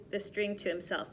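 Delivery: ordinary delivery, nothing marked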